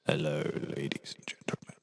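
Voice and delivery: croaky, whispered morning voice. Real, not acted. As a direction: rasp it out, barely awake. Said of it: "croaky morning voice".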